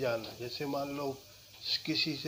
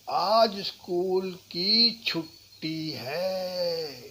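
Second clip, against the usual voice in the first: छुट्टी stressed, whole line slow and louder; 3 semitones higher, 6 dB louder, much slower than usual. Shown as a demonstration. Teacher demoing stress on छुट्टी. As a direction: lean on छुट्टी slowly. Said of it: "छुट्टी पे जोर देना है".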